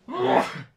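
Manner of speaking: sudden loud sneeze. Real, not acted. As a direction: let out a real sneeze. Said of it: "I'm gonna sneeze".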